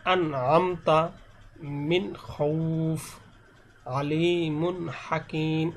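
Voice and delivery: "আলিমুন হাকিম" slow, long vowels, n sounded without a hum; clear izhar. Shown as a demonstration. Teacher showing ghunnah is not allowed, clear n. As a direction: recite "মিন খৌফ" with a clear unheld n. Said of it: "এখানে এরকমভাবে গুণনা করা যাবে না".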